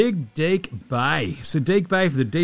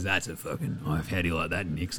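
much lower and softer, menacing growl; low menacing movie tough guy; 9 semitones lower, 8 dB quieter than usual. Retold or imitated. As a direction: growl it like a tough guy showing his knife. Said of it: "K D just whips out his hog and goes".

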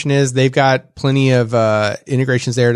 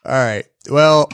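ordinary talk, nothing marked

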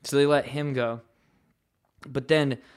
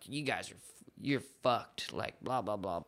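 mock old man; 8 semitones lower, 12 dB quieter than usual.

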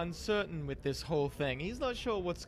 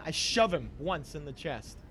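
normal talking voice

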